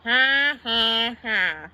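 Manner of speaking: spoken ha-ha, stepping downward; humourless laugh. A narrator's reading. Bert answers the prank without smiling, deadpan fake laugh. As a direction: say each ha separately, without any mirth. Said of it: "said Bert without smiling".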